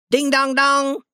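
high, loud bell imitation; bell noise. Ben imitates a riverboat bell, high and loud. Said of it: "like a bell".

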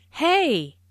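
high, sharp "Hey!" in surprise; surprised call. Shown as a demonstration. Teacher demonstrates a startled "Hey!". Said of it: "used to show surprise".

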